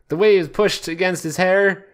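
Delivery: normal talking voice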